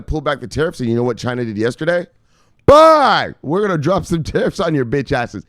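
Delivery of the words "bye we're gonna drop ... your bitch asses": a high, loud mocking "Bye!", then a cocky jeer; mock taunting shout. Vlogger voicing China taunting Americans, high mock shout.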